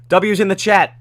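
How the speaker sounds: hype shout-out. Real, not acted. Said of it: "I've always wanted to say this".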